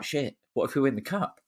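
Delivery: retold dawning realisation, rising at the end; sudden realisation. Fan retelling his second thought about the cup, startled. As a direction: act out the thought suddenly hitting you. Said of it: "And I'm like".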